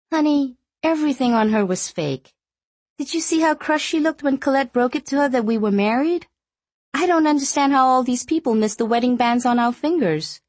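put-on amused young wife, gossiping; young woman voice. Female character dialogue.

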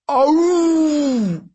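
loud, high, sustained howl; long giant's yowl of pain. A narrator's reading. Giant stabbed in the thigh, howling.

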